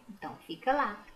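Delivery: ordinary talk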